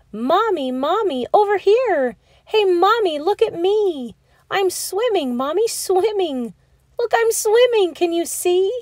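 put-on proud child showing off his swimming; thrilled child calling. Pip-Pip calls to mommy from the water, thrilled.

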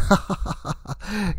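shrill cackle at his own joke; high cackling vampire laugh. Acted. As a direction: cackle with glee. Count laughs again.

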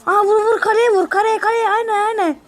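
high, eager urging; excited cheering. Real, not acted. Gamer urging his troops onto the tower, excited.

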